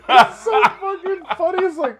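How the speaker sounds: high, loud squeal of laughter; real helpless laughter. Real, not acted. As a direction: squeeze it out while cracking up. Cracking up over the joke.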